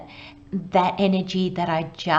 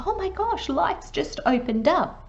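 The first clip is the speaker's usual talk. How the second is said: high-pitched amazed outburst; suddenly really excited; 9 semitones higher than usual. Acted. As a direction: start way up high, as if life just opened up. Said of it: "I feel suddenly really excited".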